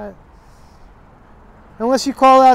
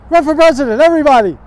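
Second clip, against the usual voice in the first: higher, louder mocking cry; loud call; 6 semitones higher, 6 dB louder, faster than usual. Real, not acted. Redoing the line without the curse, loud and mocking. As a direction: call it out loud like a carnival barker. Redo after apologizing for cursing.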